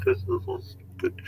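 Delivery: breathy whisper of mock despair; hushed, defeated whisper. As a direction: whisper it like you give up. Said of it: "And I'm like".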